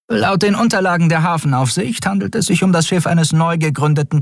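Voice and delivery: high-pitched officer's report voice; dutiful first officer. A narrator's reading. Shia reporting to the admiral, dutiful.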